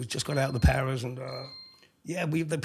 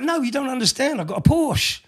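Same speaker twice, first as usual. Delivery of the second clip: protesting; 10 semitones higher, 7 dB louder than usual. Retold or imitated. Protesting to the immigration officer.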